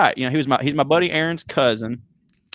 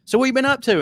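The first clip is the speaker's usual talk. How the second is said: friend's question; 11 semitones higher, faster than usual. Retold or imitated. Friend's question voiced in story.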